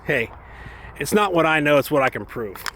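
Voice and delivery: ordinary talk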